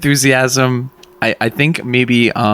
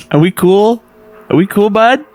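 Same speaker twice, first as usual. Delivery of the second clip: higher, sheepish sweet-talking; coaxing; 9 semitones higher, 4 dB louder than usual. Acted. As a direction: coax a sulking friend back. Coaxing the sulking sword.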